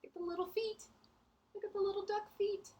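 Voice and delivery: high, squealing delight at little feet; playful cooing at the picture. Acted. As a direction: coo over the tiny duck feet.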